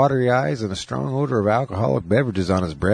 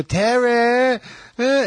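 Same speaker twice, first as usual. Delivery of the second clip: put-on child shouting name; much slower than usual. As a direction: yell the name like a scared toddler. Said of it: "He'll go".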